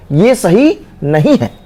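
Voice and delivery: normal talking voice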